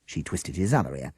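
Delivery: ordinary delivery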